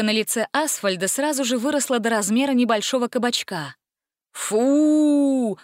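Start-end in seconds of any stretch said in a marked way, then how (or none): 4.3-5.6 loud, drawn-out disapproving boo; a whole hall going ugh; loud crowd groan of disgust. A narrator's reading. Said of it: "дружное неодобрение".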